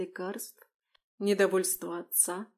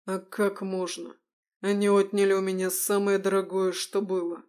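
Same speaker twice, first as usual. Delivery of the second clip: through gritted teeth; 4 dB louder than usual. Acted. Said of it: "процедил сквозь зубы".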